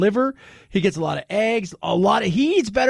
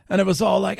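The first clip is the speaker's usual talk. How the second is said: ordinary delivery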